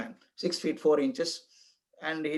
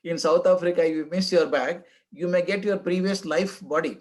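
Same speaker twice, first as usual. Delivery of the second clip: ordinary talk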